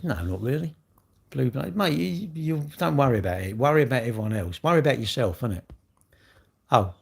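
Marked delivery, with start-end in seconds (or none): none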